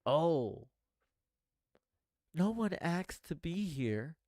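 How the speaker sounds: a light mocking sigh of defeat; mock defeatist whine. Mocking a defeatist viewer's complaint, mild mock whine. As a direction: parrot the gloomy line, mildly mocking. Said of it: "But to say that".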